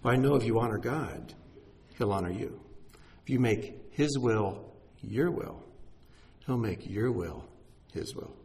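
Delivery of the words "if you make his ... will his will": slow, stress on "your" and "his"; emphatic wordplay. Shown as a demonstration. Preacher turning the phrase for the congregation, slow and emphatic. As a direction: lean on "your will" then "his will". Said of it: "slow emphatic wordplay".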